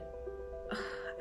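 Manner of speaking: soft breathy sigh; overwhelmed sigh. Real, not acted. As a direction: let out a quiet sigh. Overwhelmed by too many names.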